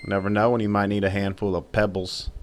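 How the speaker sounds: ordinary talk, nothing marked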